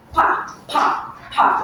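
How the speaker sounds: "pa" with an airy burst from the lips; puff of air. Shown as a demonstration. Teacher shows class a plosive, "pa" aspirated. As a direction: pop the P in "pa" with a puff of breath. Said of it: "Parang may hangin".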